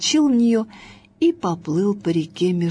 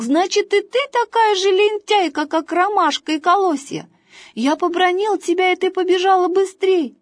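put-on high, loud scolding boy; 12 semitones higher, 5 dB louder than usual. A narrator's reading. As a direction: scold the river smugly.

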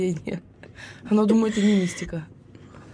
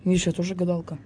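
ordinary delivery

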